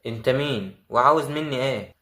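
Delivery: usual speaking voice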